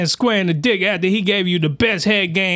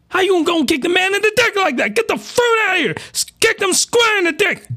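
outraged; 9 semitones higher than usual. Real, not acted. Outraged reaction to kick.

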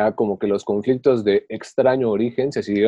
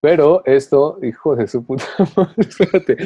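cracking up mid-sentence; laughing; 4 semitones higher, 6 dB louder than usual. Real, not acted. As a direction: talk through laughter. Laughing fit mid-story.

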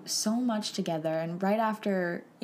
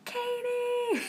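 high, drawn-out calling of a name; faint distant call; 14 semitones higher, much slower than usual. Retold or imitated. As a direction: call out as if from far off, high and held. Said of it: "I hear this very faint".

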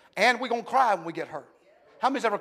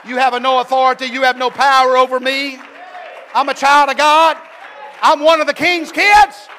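rising to a loud, high preaching shout; a preacher defying the devil, climbing to a shout; preaching at full force; 7 semitones higher, 15 dB louder than usual. Acted. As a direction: build each claim louder and higher.

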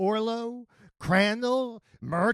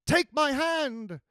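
high-pitched mercenary nun, slow; Murta's character voice; 10 semitones higher, 4 dB louder, slower than usual. A narrator's reading. Mercenary nun to the lady amid the fight, high voice. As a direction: call it out high, drawing it out.

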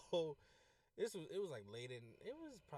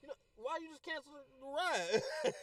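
high, loud, drawn-out disbelief; half-laughing outraged demand, slowed for effect; mock indignant confrontation; 13 semitones higher, 11 dB louder, slower than usual. Driver confronting passenger who cancelled, mock outrage.

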